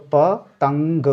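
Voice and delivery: पतंग said slowly and clearly; slow demo. Shown as a demonstration. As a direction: stretch out पतंग carefully. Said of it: "ता पे है". (धीरे और साफ़)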